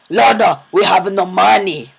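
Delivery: put-on loud, slow, hammy Russian accent; terrible Russian accent. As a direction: yell it in a bad Russian accent. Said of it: "terrible, Russian accent".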